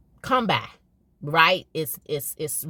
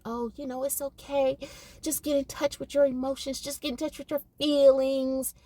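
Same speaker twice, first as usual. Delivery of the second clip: higher, soft mocking coddling voice; mock soft-hearted coddler; 8 semitones higher than usual. Mocking parents who coddle crying boys, soft high voice. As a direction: mimic a gushy feelings-talk parent. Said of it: "Don't encourage".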